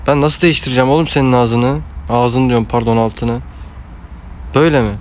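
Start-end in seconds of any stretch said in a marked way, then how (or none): none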